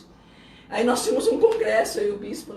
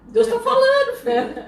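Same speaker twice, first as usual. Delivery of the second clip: high, loud, laughing insistence; playfully emphatic retold outburst; mock exclamation; 13 semitones higher, 8 dB louder than usual. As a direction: cry it out high, half laughing.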